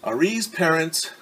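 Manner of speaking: lively storybook narration, rising on the end; reading to children. A narrator's reading. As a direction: read it like a picture book to kids.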